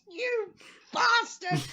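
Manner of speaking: high, loud mock outburst; mock outraged yell. At the friend who texted mid-tearjerker, high loud mock yell. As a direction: yell it like you've been betrayed at the worst moment. Reacting to text during sad scene.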